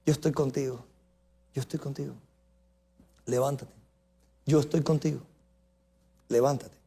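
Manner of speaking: soft, low, hushed voice of God; gentle whisper. Acted. God speaks in the gentle wind, soft and low. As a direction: barely breathe it, tender and close.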